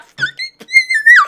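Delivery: squealing burst of laughter; high shrieking laugh. Real, not acted. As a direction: lose it completely. Cracking up mid-rant.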